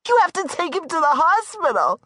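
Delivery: high woman's voice, urgent; frantic Darla. Acted. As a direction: insist he take the man away.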